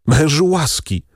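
begging for mercy. Acted. Desperate wife begging her husband for mercy. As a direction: throw yourself on his mercy.

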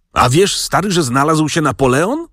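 high-pitched excited news-breaking; narrated excited outcry at normal volume; agitated exclamation. A narrator's reading. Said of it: "dziwnie wzburzony i stojąc na środku izby zawołał".

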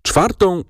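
"Czwartą" pitched high, then cut off; breaking off stuck. A narrator's reading. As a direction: begin "Czwartą" high and stall after it. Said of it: "tu zaciął się". („Czwartą” wysoko i urwane)

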